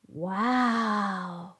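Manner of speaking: a mild, breathy wow of amazement; stunned gasp. A narrator's reading. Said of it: "gasped Lauren".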